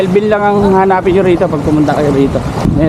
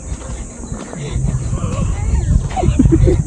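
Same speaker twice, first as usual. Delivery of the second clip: high breathy laugh; 6 dB quieter than usual. Real, not acted. Laughing at baby fish.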